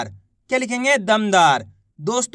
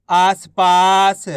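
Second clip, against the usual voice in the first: आसपास drawn out very slowly and louder; slow blended word; 3 semitones lower, 5 dB louder, much slower than usual. Shown as a demonstration. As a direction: stretch it out to show the blend. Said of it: "तो मिलके बन जाएगा". (धीरे धीरे खींचकर)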